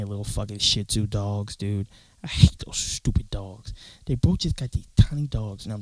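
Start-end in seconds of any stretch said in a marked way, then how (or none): 2.2-3.7 hushed, slow contempt; slow whispered grumble. Real, not acted. Complaining about the neighbors' tiny dogs, whispered. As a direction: whisper it like a secret grudge.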